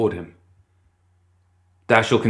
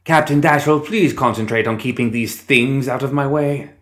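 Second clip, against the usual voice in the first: calling out; 5 semitones higher than usual. Acted. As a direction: call out over the battle. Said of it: "he called out".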